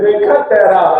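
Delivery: mock divine rebuke; lightly voiced God telling him off; God scolding.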